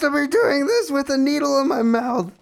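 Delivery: high, strained, mock-panicked protest; exasperated outburst. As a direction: squeal it out in disbelief as the needle goes in. Complaining about the rough injection.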